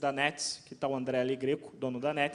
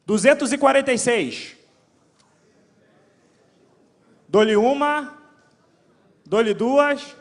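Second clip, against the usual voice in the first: loud, high auctioneer call; going once, going twice, auction style; 6 semitones higher, 11 dB louder, slower than usual. Pretend. Number and auction countdown.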